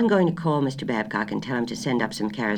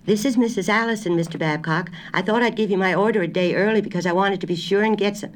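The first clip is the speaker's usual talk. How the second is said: raised, higher voice to be heard; calling over a bad line; 8 semitones higher, 3 dB louder than usual. A narrator's reading. Wife calling grocer on bad phone line, raised voice. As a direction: speak up into a crackly phone.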